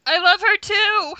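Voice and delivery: squealing with delight; excited. Real, not acted. Gushing over a favourite character.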